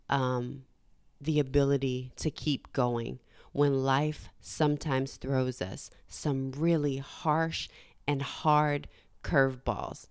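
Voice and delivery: usual speaking voice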